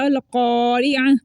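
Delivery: अल क़ारिअह with thick q, "qawri'ah"; slow, rounded क़ारिअह; mouth-filling qaf. Shown as a demonstration. Teacher illustrating the rule, mouth-filling qaf. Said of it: "मुंह भर के ये भी पढ़ा जा रहा है".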